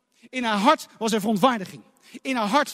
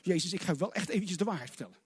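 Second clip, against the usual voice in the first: mock inner grumble; 5 semitones lower, 11 dB quieter, faster than usual. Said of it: "diep in haar hart ook al van".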